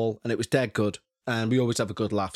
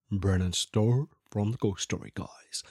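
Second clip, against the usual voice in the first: badly faked low host voice; a second, botched stab at a cool podcaster's low voice; Brennan Storr; 5 semitones lower, 4 dB quieter than usual. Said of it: "That was terrible".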